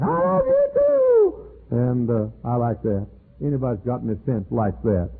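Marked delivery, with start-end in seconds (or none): 0.0-1.3 very high, slow, stretched call; screaming back. Retold or imitated. Preacher calling back to the kids, high and slow. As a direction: holler it back high and drawn out. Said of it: "And I scream back".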